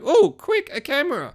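very high, put-on excited squeal; high sarcastic eagerness; mock excitement. Mocking eager reaction to a camera.

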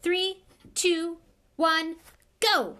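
loud, high call of the start; shouted countdown. Acted. Lockhart shouting to start the duel, loud countdown. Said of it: "he shouted".

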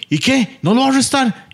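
Orión can't believe it; lightly hammed disbelief; indignant Orión. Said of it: "se queda Orión".

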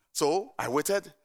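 ordinary talk, nothing marked

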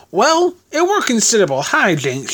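high, folksy landlady voice; chatty landlady. Acted. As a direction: answer brightly in a high character voice. Landlady answers in character.

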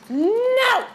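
sudden high shout; startled shout. Real, not acted. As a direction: yelp it out loud. Attacked in game.

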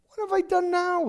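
high, hurt little-brother whine; whiny protest. Acted. David answering his angry big brother, high and whiny. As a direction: protest your innocence, high and wounded.

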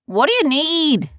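high, loud call from a ship; brash loud ship hand. Acted. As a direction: holler down at strangers.

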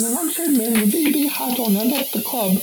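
high-pitched Eastern European woman; put-on Eastern European accent in a high voice. A narrator's reading. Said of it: "responded in an Eastern European accent".

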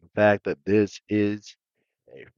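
put-on Southern accent. Riffing on Texas, light slow Southern accent. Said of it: "talking real slow".

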